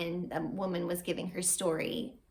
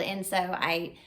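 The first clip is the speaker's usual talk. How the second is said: ordinary delivery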